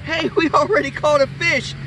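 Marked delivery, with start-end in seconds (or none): none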